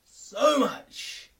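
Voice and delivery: loud, high burst of loathing; exasperated outburst. Real, not acted. Vents hatred of own voice.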